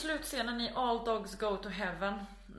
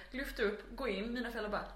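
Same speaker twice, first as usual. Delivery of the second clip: usual speaking voice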